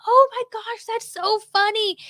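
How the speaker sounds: high, gushing mock teen voice; put-on high-pitched delight; imitated amused teens. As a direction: mimic kids finding it hilarious. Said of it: "They were like".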